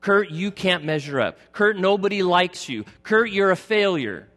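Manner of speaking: accusing inner voice. Retold or imitated. Voices of self-condemnation, mocking.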